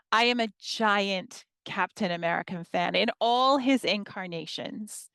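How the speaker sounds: normal talking voice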